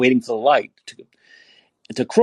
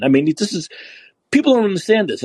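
usual speaking voice

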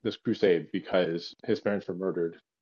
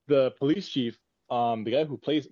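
ordinary talk, nothing marked

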